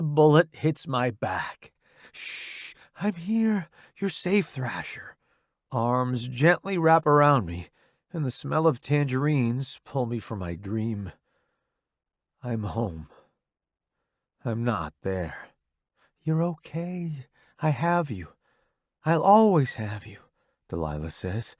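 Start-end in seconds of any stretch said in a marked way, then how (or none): 2.1-5.2 soft hushing comfort; calm him gently; soothing. Acted. Wife soothing after nightmare. 16.2-20.2 tender. Acted. Delilah comforting him.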